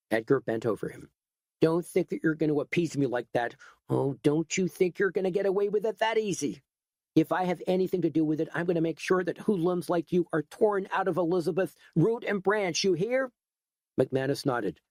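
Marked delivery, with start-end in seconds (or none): 1.6-13.3 higher, fast, heated threatening; angry threat. Acted. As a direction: threaten him, rising fury. Edgar threatening McManus.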